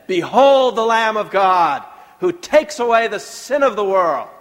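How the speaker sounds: loud preacher's boom; stentorian shout. Shown as a demonstration. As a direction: proclaim it to the back balcony. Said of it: "a more stentorian voice".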